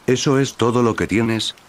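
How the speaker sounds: ordinary delivery, nothing marked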